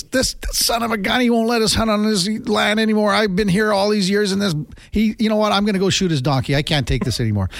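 hammed-up high-pitched indignant rant; pretend upset. Storyteller plays Mickey faking anger at the farmer, mock upset. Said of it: "he pretended he was upset".

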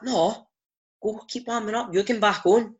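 bossy coach. Retold or imitated. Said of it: "He's like".

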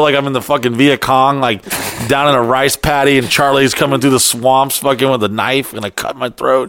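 ordinary talk, nothing marked

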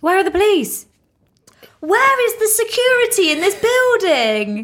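high-pitched, hammed-up indignant demand; mock outrage. Mock outrage at late police.

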